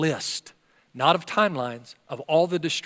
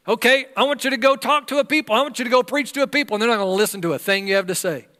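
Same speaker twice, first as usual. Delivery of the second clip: fast, higher rundown of orders; God's brisk charge; 5 semitones higher, faster than usual. Acted. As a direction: rattle off the mission quickly. God giving Isaiah his charge.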